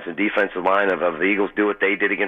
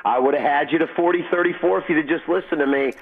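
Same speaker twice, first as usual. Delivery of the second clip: higher mock-nagging wife voice; husband imitating his wife's higher nagging; 8 semitones higher than usual. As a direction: needle him like a told-you-so spouse. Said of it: "I had to hear her nagging me".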